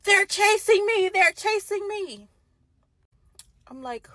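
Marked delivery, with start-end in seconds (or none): 0.0-2.3 high, loud, drawn-out mock scream; panicked yell, each word stretched out. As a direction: imitate his frantic phone scream. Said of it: "He called me screaming".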